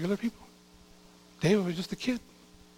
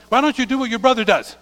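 higher, louder nagging parent; scolding parent; 4 semitones higher, 5 dB louder than usual. Acted. Parent comparing son to his siblings, raised scolding. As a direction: scold a son for not listening. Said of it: "it's like you telling your son".

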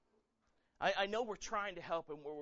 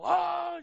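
loud, high imitated mourner's wail; mock wail; 15 dB louder than usual. Pastor imitating professional mourners, a high loud wail. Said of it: "if you wailed, they would wail".

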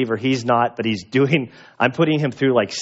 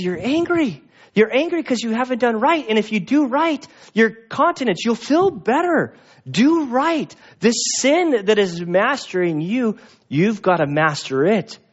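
a higher, pressing voice of God; urging God voice; 9 semitones higher than usual. Acted. God urges Cain to master sin, higher. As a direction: push Cain to do right. God's words paraphrased to Cain.

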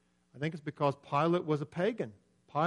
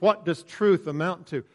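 ordinary delivery

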